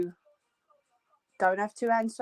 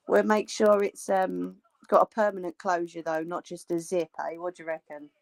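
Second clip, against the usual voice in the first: ordinary talk, nothing marked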